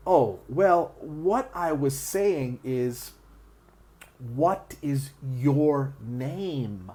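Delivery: slow, careful repeat; very slowly. Retold or imitated. Native speaker repeating the question, very slow and careful. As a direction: spell it out slowly for a learner. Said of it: "they're gonna speak very slowly".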